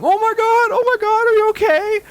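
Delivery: very high frantic mother voice; high panicked mother rushing to her son; panicked mom. Retold or imitated. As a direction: shriek like a worried mom.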